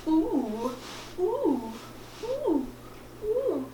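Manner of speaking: string of short high-pitched "ooh" hoots; repeated silly falsetto oohs; playful high oohs. Pretend. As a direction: hoot "ooh" up high, over and over. Playful hooting before stopping herself.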